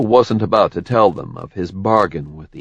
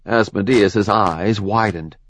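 plain reading voice

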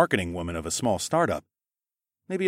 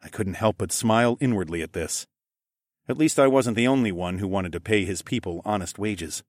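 usual speaking voice

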